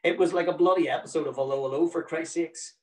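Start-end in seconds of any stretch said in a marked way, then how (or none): none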